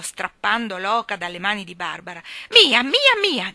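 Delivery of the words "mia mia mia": put-on high, loud little-girl squeal; shouting. A narrator's reading. Little girl grabbing the goose back, shouting. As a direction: shriek it possessively. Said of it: "gridò Barbara".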